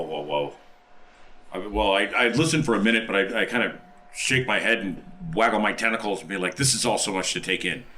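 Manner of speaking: ordinary talk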